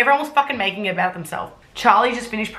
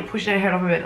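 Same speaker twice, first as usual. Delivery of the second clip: ordinary talk, nothing marked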